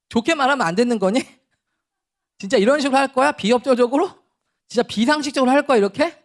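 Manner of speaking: put-on loud, exasperated scolding of a child; mock-angry dad losing patience; angry scolding father. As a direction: scold your kid like you've told him ten times. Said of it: "저희가 이렇게 화를 내거든요".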